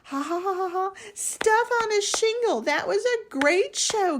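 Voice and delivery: high, loud giggling through the words. A narrator's reading. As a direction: laugh it out high. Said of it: "giggled Salt".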